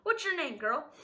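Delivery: high, loud interrogation; curmudgeonly demand. A narrator's reading. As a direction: demand the name loudly, starting high.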